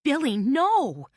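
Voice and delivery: high, loud, slow cry of protest. A narrator's reading. Carmela cries out to Billy, slow and loud. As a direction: draw out the plea in dismay. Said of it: "cried Carmela".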